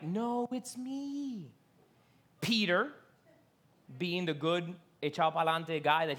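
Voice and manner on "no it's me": softer, slow, soothing reply; calm the scared friends down; calm reassurance. Retold or imitated. Jesus answering the frightened disciples, gently reassuring. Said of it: "He's like".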